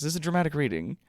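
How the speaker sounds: ordinary talk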